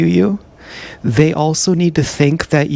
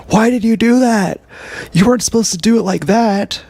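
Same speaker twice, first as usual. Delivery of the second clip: high, accusing put-on voice, much higher; a high, nagging mock accusation; mocking narcissist scold; 8 semitones higher than usual. Narcissist scolding after cup task.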